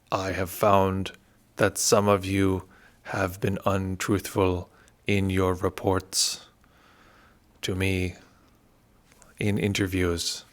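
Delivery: slow, deliberate put-on Burmese accent; a lightly done, self-admittedly bad Burmese accent, dragging each phrase; terrible Burmese accent. Teacher imitating the stern Burmese meditation master's announcement to retreatants, in a slow, bad Burmese accent. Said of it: "a terrible Burmese accent".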